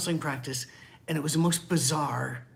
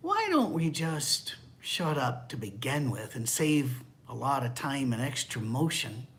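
ordinary delivery

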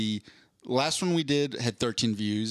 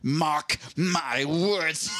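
grandiose villain oath, drawn out at the end; theatrical villain monologue building to a slow finish; supervillain vow. Acted. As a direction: swear revenge like a cartoon supervillain. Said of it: "established a supervillain".

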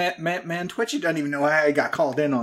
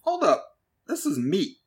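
surprised; 4 semitones higher than usual. Acted. Twitchy finds real meat, surprised and high. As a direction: stop short, pitched way up.

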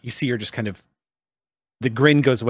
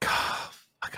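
usual speaking voice

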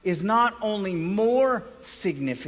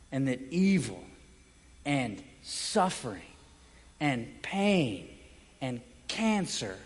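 hushed tender; 7 semitones lower, 5 dB quieter than usual. Real, not acted. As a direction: drop to a hushed, tender hush. Sermon on the Father's tender hands.

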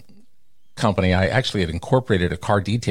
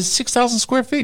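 high, rising "Six thousand square feet."; incredulous. Retold or imitated. Owner arguing with the architect, incredulous rise. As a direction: push it up in disbelief. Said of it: "I said".

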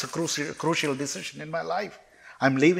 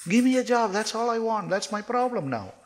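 much higher, urgent appeal; insistent pleading; 10 semitones higher than usual. Retold or imitated. Jobless man presses God for a job, insistent pleading. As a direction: beg for it in a high, pressing voice.

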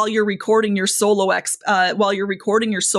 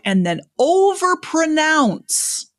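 overpronounce drawn out slowly and higher; overpronounced word; 6 semitones higher, slower than usual. Shown as a demonstration. Host demonstrating to the guest how Seth emphasizes, slow overpronounced word. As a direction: stretch out every syllable of overpronounce. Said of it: "he'll emphasize a word by overpronouncing it".

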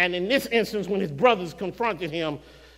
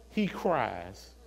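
ordinary talk

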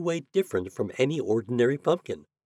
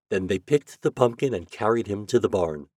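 ordinary delivery, nothing marked

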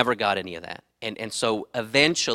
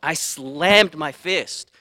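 frustrated retelling; 4 semitones higher, 3 dB louder, much slower than usual. Retold or imitated. Reliving slamming fist in frustration.

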